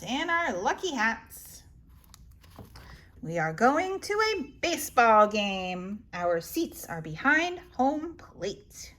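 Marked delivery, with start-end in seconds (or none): none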